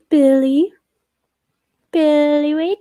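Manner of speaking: high, loud rising call; calling a pet. Real, not acted. Owner calling cat Billy to meet Mags, high rising call. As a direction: sing out the pet's name, rising at the end.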